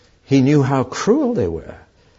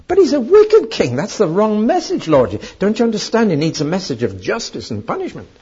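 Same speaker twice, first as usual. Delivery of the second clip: high-pitched, incredulous objection; indignant protest; 7 semitones higher, 3 dB louder than usual. Retold or imitated. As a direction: protest in dismay, voice jumping up high. Acts out Jonah protesting to God.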